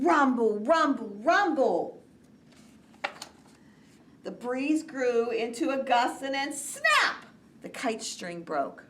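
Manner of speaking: lively, animated read-aloud; reading to children. A narrator's reading. Teacher reading Kite Day to young children, animated. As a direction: read it with big storybook energy. Reads picture book aloud.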